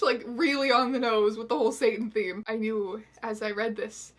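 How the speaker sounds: ordinary delivery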